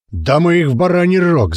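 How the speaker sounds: high, brash shout; yelling. Acted. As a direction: yell it like a cocky brawler. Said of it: "заорал Клоп".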